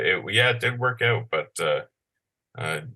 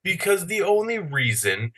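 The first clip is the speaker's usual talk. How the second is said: ranting; 9 semitones higher than usual. Retold or imitated. Ranting at traffic.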